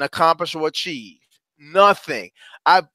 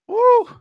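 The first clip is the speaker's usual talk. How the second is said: hype whoop; 3 dB louder than usual. Real, not acted. Hype shout after slogan.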